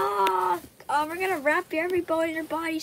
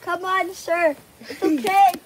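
frantic yelling; 11 dB louder, much slower than usual. Pretend. Frantic rescue of patient.

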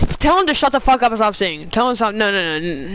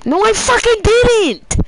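high, slow furious denial; angry yell; 8 semitones higher, 3 dB louder, slower than usual. Real, not acted. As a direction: yell it in disbelief. Yelling at muted teammate.

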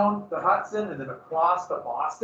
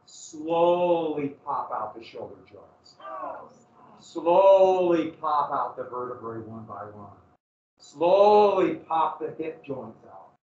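slow ominous relish; 3 semitones lower, slower than usual. Acted. Lingering on slow torture.

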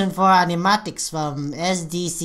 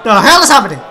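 higher, loud baffled cries; shocked disbelief; 6 semitones higher, 8 dB louder than usual. Real, not acted. Fan baffled by a city scene, shocked shout. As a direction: yell in confused surprise.

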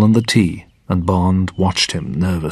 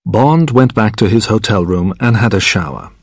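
plain reading voice

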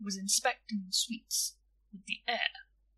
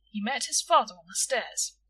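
usual speaking voice

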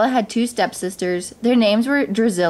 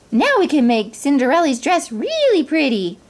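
higher, excited little mouse; eager mouse; 6 semitones higher than usual. A narrator's reading. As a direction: pipe up with a happy plan. Jack the mouse is thrilled.

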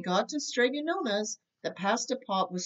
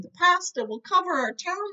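higher, louder panicked cry; frightened cry; 8 semitones higher, 3 dB louder than usual. A narrator's reading. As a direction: cry out in fear of the flood. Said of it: "they cried".

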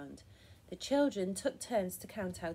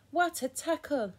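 high, louder cheer from the sidelines; excited cry; 7 semitones higher, 5 dB louder than usual. A narrator's reading. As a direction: cry it out like a watching fan. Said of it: "they cried".

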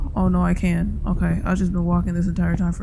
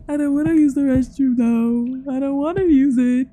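mock scared whine; 5 semitones higher, slower than usual. Scared whiny high voice.

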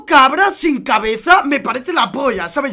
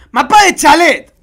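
loud, higher-pitched call-out; excited shout; 4 semitones higher, 10 dB louder than usual. Real, not acted. Streamer calling out the map, excited shout. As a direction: burst out loudly.

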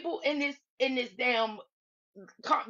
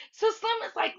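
normal talking voice

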